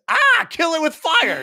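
high, loud hammed-up panicked yell; mock horror panic. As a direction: shriek like a horrified reader at a monster. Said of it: "the reaction to the horror scene".

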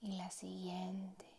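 unhurried meditation voice with long pauses; guided meditation. Acted. Meditation guide easing a listener into relaxed breathing, guided meditation voice. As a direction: guide the listener gently, letting each phrase settle.

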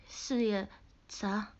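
ordinary delivery

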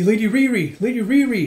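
high, loud searching call. Acted. Attendants calling out for their lady.

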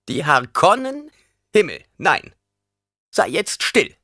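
put-on high, loud scoffing old woman; indignant old crone. A narrator's reading. Old woman scoffing at Paul and ordering silence, high and loud. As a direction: snap him silent.

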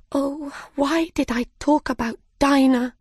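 high, rueful lament; cried out. Acted. Alice cries out to herself in regret, high. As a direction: cry it out in regret. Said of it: "cried Alice".

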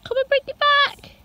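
high, far-carrying call to the dog; high sing-song call to a dog. Real, not acted. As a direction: call him back across the garden. Calling the dog to fetch.

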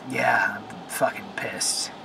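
hushed, fed-up whisper; a muttered aside of real frustration; whispered anger. Real, not acted. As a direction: breathe it out, barely voiced. Ranting about car wash, drops voice.

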